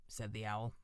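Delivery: ordinary delivery, nothing marked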